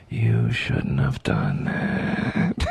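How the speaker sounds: very low, very slow menacing threat; menacing movie villain. Acted. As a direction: drag each word out deep and deadly like a film villain. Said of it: "my new fantasy".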